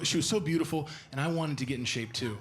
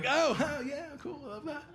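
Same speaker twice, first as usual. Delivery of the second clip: imitating girlfriend nibbling; 13 semitones higher than usual. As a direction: coo while nibbling. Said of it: "nibble it like Missus Pac-Man".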